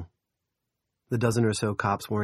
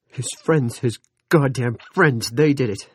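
anguished fury; 5 semitones higher, 8 dB louder than usual. Acted. Pete's grief turned furious.

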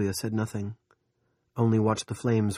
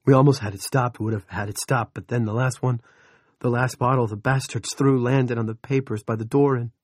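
louder, higher distraught outpouring; near wailing; 3 semitones higher, 6 dB louder than usual. Acted. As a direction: pour it out, on the edge of a wail. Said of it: "He was nearly wailing".

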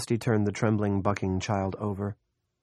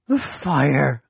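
high trembling scream; shaky nervous falsetto; 7 semitones higher, 10 dB louder than usual. A narrator's reading. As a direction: scream it high and shaking with fear. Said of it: "screamed in a high, trembling voice".

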